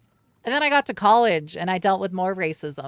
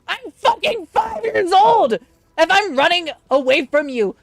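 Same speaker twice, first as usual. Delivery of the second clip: indignant outburst; 10 semitones higher, 7 dB louder than usual. Retold or imitated. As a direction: fire back at the teacher, high and loud. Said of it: "I'm just like".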